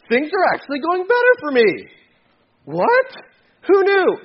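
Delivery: high-pitched amazed discovery; acted astonishment. Believer finding God's way works better, astonished. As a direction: jump up high in disbelief.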